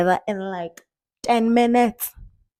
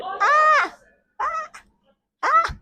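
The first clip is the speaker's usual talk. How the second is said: high sharp yelps; shocked yelps at the taste; much slower than usual. Real, not acted. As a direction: yelp as the burn hits. Reacting to strong ginger shot.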